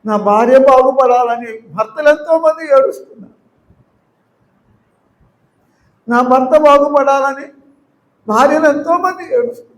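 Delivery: high, loud preacher's cry, building; impassioned preaching. Acted. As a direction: plead with the congregation, voice lifted high. Preaching climax, rising, loud.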